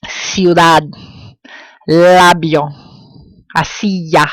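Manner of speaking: "Ciudad, labio, asilla" with vowels run into one sound; vowel pairs blended. Shown as a demonstration. Teacher listing diphthongs, "Ciudad, labio, asilla" with gliding vowels. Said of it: "These are forming separate sounds".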